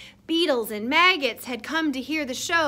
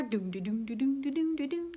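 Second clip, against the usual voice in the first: soft plucked bass doom-da-doom; mouthed bass guitar; 8 dB quieter, faster than usual. Pretend. Reader pretends to play bass guitar for the children, mouthed bass. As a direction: play a bass line with your mouth, quietly. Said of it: "pretend you got your bass guitar".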